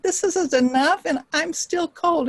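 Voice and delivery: high, whiny beggar complaint; acted needy stranger; complaining beggar. Beggar complains of food and cold.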